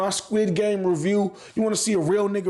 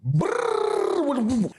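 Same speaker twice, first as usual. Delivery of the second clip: imitated gunfire; 6 semitones higher than usual.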